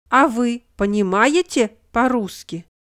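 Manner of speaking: ordinary talk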